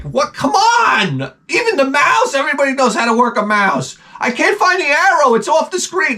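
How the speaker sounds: high, frustrated pleading; exasperated. Retold or imitated. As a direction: beg in disbelief. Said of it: "you're like".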